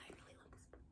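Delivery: hushed, secret whisper; a breathless whispered admission. Real, not acted. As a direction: whisper it like a confession. Confides her love of the book.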